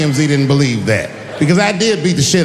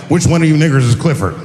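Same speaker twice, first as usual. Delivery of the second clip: gruff cop voice; 3 semitones lower, 4 dB louder, faster than usual. Retold or imitated. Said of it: "They don't show up like".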